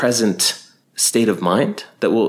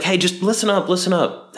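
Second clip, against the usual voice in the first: much higher, casual, chummy version of Antony; mock Mark Antony; 11 semitones higher than usual. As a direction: play Antony as a pal hushing a crowd. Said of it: "Mark Antony's like".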